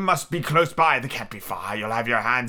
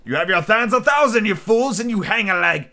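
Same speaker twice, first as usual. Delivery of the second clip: raised pirate tirade, pushed higher; berating rant; 5 semitones higher, 3 dB louder than usual. A narrator's reading. Blind Pew berating his fools of a crew, ranting. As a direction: scold the cowards, stretching key phrases.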